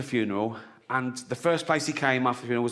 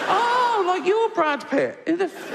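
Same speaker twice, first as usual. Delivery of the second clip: much higher, slow indignant squeak; mock offended; slower than usual. Atheist answering Satan's insult, high indignant squeak. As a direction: squeak it out in camp outrage. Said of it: "And I go".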